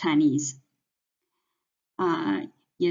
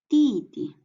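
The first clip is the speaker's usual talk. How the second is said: 弟弟 with a loud, high first 弟, second light; stress on first syllable; 8 semitones higher, 5 dB louder than usual. Shown as a demonstration. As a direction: hit the first 弟 in 弟弟. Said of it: "to emphasize the first word".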